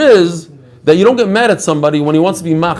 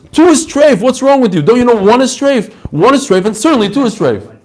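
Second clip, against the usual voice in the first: high, hammed-up scolding rebbe; imitating a teacher yelling at his class; mock exasperated yell; 7 semitones higher than usual. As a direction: scold the students for a silly question. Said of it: "he screams at them".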